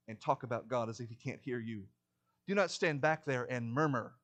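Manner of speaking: ordinary talk, nothing marked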